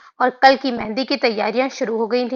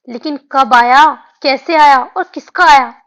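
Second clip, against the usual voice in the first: louder, rapid-fire eager questions; excited barrage of questions; 7 dB louder than usual. Acted. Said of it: "सवालों की बौछार कर दी थी".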